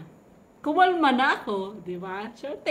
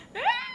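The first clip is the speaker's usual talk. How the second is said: very high, thin mock squeal; squeaky held-back retold scream. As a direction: imitate your scream without the volume. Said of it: "sumigaw ako".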